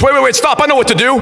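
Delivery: mock urgency, cutting in to stop the drill; urgent call. Player [name] stopping the drill to save the baby, urgent.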